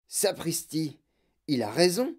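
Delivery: lightly voiced amazed grandfather; grand-papa Joe. A narrator's reading. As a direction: exclaim in a slightly altered old man's voice. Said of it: "dit grand-papa Joe". (voix légère de grand-père étonné)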